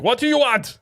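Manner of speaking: high, loud mock-German shout; hammed-up aggressive German server; aggressive German yell. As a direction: yell at a customer like an angry German. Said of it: "very aggressive German, yelling out things".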